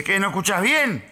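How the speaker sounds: mildly heated mock query; irritated retold question. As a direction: ask it like an annoyed stranger. Acted stranger's first irritated question.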